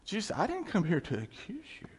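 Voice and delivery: usual speaking voice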